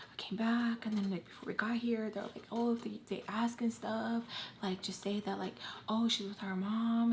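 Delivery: very soft, half-whispered retelling; confidential hush. Retold or imitated. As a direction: let it slip quietly, like a secret. Retells a cover story confidentially.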